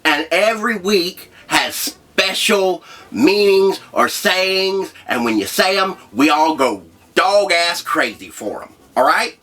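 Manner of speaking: high, slow, hyped announcer read; show host intro. Acted. Host opening the first episode, hyped announcer voice. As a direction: pump up the audience like a show host.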